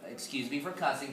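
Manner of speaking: usual speaking voice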